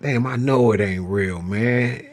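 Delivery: usual speaking voice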